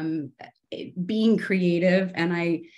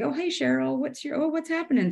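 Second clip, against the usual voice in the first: high, sing-song mock greeting; sweet greeting to a tree; 9 semitones higher than usual. Checked-out woman greeting her doodled tree in a high chirp. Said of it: "Sometimes Cheryl was the tree".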